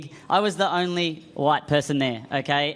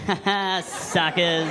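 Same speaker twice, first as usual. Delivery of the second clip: smug gloat; much slower than usual. Retold or imitated. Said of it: "you just go".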